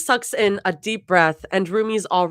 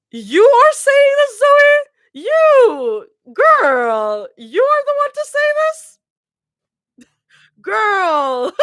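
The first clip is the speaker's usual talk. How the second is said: high, loud squeal of disbelief; incredulous outcry; 9 dB louder, much slower than usual. Real, not acted. Reader calls out Zoe's hypocrisy, incredulous squealing. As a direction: cry out high in mock outrage at a character.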